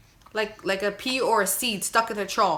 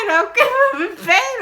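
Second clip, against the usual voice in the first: laughing cry; 13 semitones higher, 7 dB louder, slower than usual. Real, not acted. Laughing while still imitating cry.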